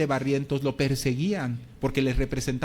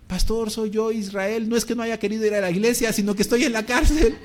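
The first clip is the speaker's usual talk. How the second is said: higher, urgent voice explaining from jail; pleading prisoner; 6 semitones higher, 4 dB louder than usual. Retold or imitated. Israel calls the pastor from jail, higher anxious pleading. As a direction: plead your case anxiously.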